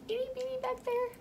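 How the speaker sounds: tiny squeaky voice for a tiny seed; itty bitty voice. Pretend. Tiny seed, high whisper.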